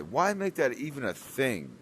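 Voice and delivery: high, loud, outraged question; loud exasperated complaint. Real, not acted. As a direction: throw the question at the game. Exasperated complaint, then repeated.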